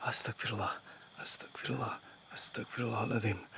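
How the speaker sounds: very soft, breathy repeated plea; whispered prayer. Real, not acted. Ghost hunter steadying himself near the spirit, whispered prayer. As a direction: murmur it almost without voice.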